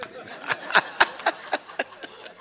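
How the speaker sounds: long, breathless wheezy laugh; real laughing fit. Real, not acted. Amused that your old style comes out, real wheezing laugh. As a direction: laugh helplessly without words. Laughing at his own remark.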